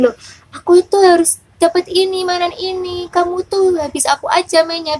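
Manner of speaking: high, quick mock child voice; imitated child protesting. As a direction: mimic a kid insisting on the toy. Voices the child's selfish thought. (menirukan anak protes dengan suara tinggi)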